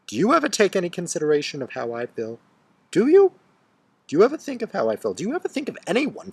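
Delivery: building to a high, loud shout, fast; furious outburst. Acted. Furious confrontation with Bobby.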